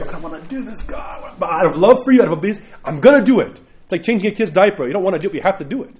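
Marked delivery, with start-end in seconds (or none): 0.0-1.3 reluctant quiet prayer. Retold or imitated. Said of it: "I go". 1.4-3.6 louder, firm vow to God; resolute declaration. Retold or imitated. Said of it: "I go".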